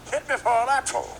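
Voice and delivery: high, loud cartoon rooster bluster; hammed-up preacher-rooster voice; Sharpton Leghorn. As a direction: boom it out like a blustering cartoon bird. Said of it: "Sharpton Leghorn".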